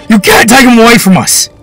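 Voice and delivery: high, loud desperate protest; loud desperate plea; pleading shout. Acted. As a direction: shout to keep his father. Boy protests, screaming.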